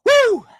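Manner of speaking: loud, very high sarcastic woo; mock whoop. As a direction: whoop like a hyped crowd.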